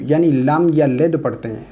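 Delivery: normal talking voice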